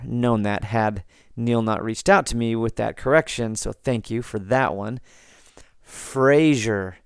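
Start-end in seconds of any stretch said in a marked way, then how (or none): 5.8-7.0 Fraser with a "zh", like FRAY-zher; mispronounced name. Shown as a demonstration. Podcaster showing the wrong way, Fraser as FRAY-zher. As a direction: soften the middle of Fraser to "zh". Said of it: "is not Fraser".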